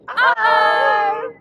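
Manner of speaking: loud cartoon exclamation; shouted uh-oh. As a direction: call it out loud. Parody catchphrase shouted.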